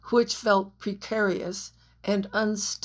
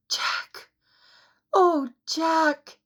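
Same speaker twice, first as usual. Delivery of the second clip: breathless gasp, then high cry of his name; wife below gasping up at Jack, breathless; 8 semitones higher, much slower than usual. A narrator's reading. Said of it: "Anne gasped".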